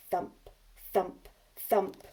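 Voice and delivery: put-on lively read-aloud for young children; story reading. A narrator's reading. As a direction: read it big and bouncy for little ones. Reads picture book to class.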